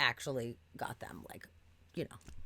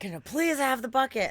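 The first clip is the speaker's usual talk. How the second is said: higher, louder pleading; exasperated plea; 9 semitones higher, 7 dB louder than usual. Retold or imitated. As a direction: beg with worn-out patience. Pleading at the drive-thru.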